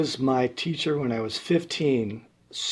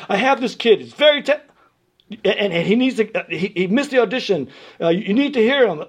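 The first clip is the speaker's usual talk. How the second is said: urgent teacher on phone; 7 semitones higher, 6 dB louder than usual. Retold or imitated. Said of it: "calls up the school".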